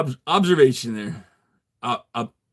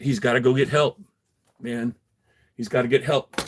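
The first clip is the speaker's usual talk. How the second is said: usual speaking voice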